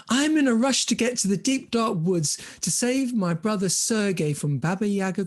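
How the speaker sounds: high, urgent voice of Olga; hurried heroine. Acted. Olga rushing to save brother.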